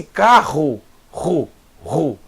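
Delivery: slow "carro", then its throaty "ru" twice alone; guttural R isolated. Shown as a demonstration. Teacher isolates the Het sound, guttural "ru" from "carro". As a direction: break "ru" off "carro", rasped in the throat. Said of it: "mesmo som do Het".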